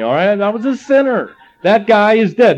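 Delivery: ordinary talk, nothing marked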